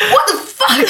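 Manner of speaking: high, disbelieving laugh; high shocked laughter. Real, not acted.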